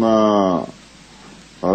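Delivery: normal talking voice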